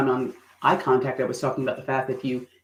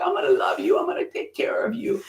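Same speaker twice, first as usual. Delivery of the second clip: loving; 14 semitones higher than usual. Shown as a demonstration. Promising care to the audience, tender and hushed. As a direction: coo it like a loving mother. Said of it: "She looks so loving".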